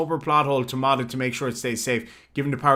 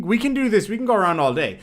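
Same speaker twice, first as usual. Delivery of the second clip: ordinary talk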